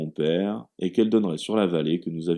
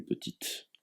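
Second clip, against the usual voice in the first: whispered aside; 14 dB quieter than usual. A narrator's reading. Aside to the girl.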